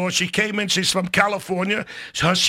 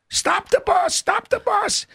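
retold screaming; 12 semitones higher, slower than usual. Father screaming to the bus driver, frantic yell. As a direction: shout it as the bus pulls away. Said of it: "I started screaming".

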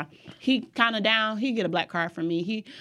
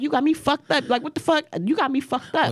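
higher-pitched indignant retort, retold; imagined cussing-out; 6 semitones higher than usual. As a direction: act out the telling-off you held back.

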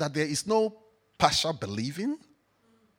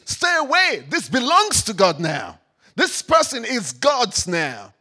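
shouted rebuke; 4 semitones higher, 8 dB louder than usual. Acted. Shouting command at the devil.

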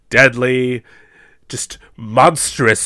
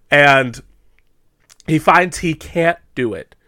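ordinary delivery

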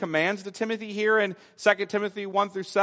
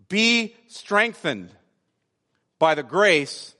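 slow solemn reading; 3 semitones lower, slower than usual. Shown as a demonstration. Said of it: "Now notice the command".

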